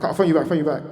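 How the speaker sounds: urgent whispered-then-loud phone sign-off; rushed loud hang-up. Retold or imitated. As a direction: blurt it as you cut off. Rich hurrying off the phone.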